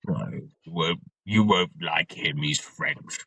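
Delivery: typical Brit. Retold or imitated. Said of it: "Rob's typical British".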